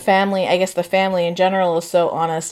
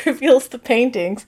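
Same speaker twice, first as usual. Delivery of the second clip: ordinary delivery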